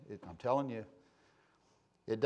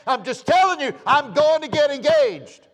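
high, very loud re-enacted insistence; full-force retold defiance; defiant shout; 12 semitones higher, 12 dB louder than usual. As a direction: shout down the plea, slowing at the end. Retells defiant answer to dispatcher.

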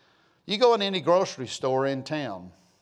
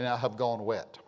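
normal talking voice

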